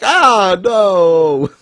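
loud, high wail of horror; long, drawn-out comic howl; mock despair. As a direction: cry out in hammed-up anguish. Imagined reaction of a character.

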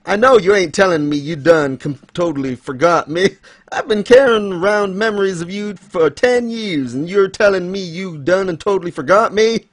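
high, mock-hurt Southern girl; Southern accent. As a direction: pile on the wronged country Southern twang. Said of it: "that's not what she actually sounds like".

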